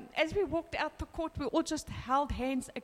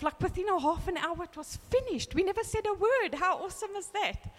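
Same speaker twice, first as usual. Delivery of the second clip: pitched-up, marvelling exclamation; real amazement; 6 semitones higher than usual. Real, not acted. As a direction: marvel at it, voice lifted high. Marvelling at the quick acquittal.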